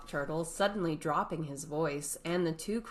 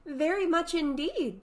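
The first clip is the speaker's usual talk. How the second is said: eager Alice; 12 semitones higher, 5 dB louder than usual. Acted.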